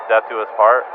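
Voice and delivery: normal talking voice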